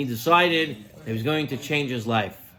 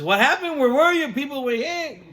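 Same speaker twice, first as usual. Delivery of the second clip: imitating an upset wife, much higher and louder; mock scolding, shrill pitch jump up high; retold angry wife; 10 semitones higher, 3 dB louder than usual. Wife confronts late husband.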